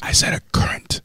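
whispering. Acted. ASMR whisper recipe reading.

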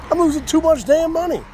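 high, loud frustrated outburst; exasperated shout. Real, not acted. Ranting to his audience about garage sale losses, loud and exasperated.

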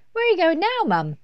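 put-on very high, loud little girl; child's questioning. A narrator's reading. Laura calls after Mum leaving the bath, high and loud. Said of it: "asked Laura".

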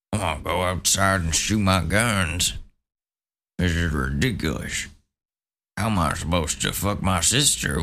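dumb mock gun nut. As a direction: parody a clueless freedom guy. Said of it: "you guys couldn't fucking stay inside".

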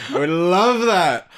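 slow, drawn-out delight. Real, not acted. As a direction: savor it with glee. Excited reaction.

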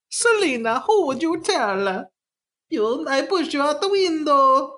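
high, squeaky Italian cartoon voice; cartoon villain voice. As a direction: threaten her like a cartoon plumber. Cartoon voice for Max Shreck.

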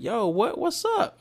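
put-on a kid who can't believe the ball popped out; shocked disbelief. Retelling the lost catch to viewers, high shocked outburst. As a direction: pitch jumps way up in outraged disbelief. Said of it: "And I'm like".

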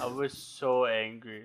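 SO drawn out slowly, low pitch; emphatic admission. Real, not acted. Friend recalling being woken up, low and emphatic. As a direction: lean slowly on "so", voice dropped.

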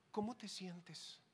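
soft, slow mock-sympathetic question; mock concern. Preacher mocking the epidemic of feelings, softly solicitous.

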